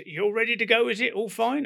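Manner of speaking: high and loud Cockney query; a chirpy Cockney pilot making sure; breezy Cockney check. Retold or imitated. Pilot checks the aircraft is ready, breezy Cockney. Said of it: "he was a Cockney".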